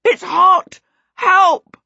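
high, loud cry for help; shrieked, panicked alarm; frightened yell. A narrator's reading. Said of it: "he looked plain frightened".

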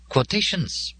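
high-pitched gentle correction; correcting. A narrator's reading. Molly corrects Joe's choice of word, high-pitched and correcting. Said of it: "Molly corrected".

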